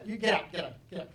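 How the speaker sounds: loud mock kicking-out; acted end-of-argument eviction; angry dismissal. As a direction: order them out in a comic huff. Acted quarrel, throwing spouse out.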